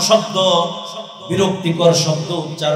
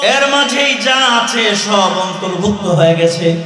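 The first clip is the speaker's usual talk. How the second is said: preaching at full force; 11 semitones higher than usual. Acted. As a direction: rouse the crowd at the top of the voice.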